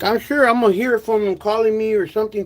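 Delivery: usual speaking voice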